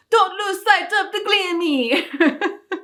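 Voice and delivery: put-on high, slow, stretched yell in a vague French accent; comic mock-French shout after fleeing brothers; Peter Stormare's French accent. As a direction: bellow it like a ridiculous villain with a not-quite-French accent. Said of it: "this ridiculous French accent".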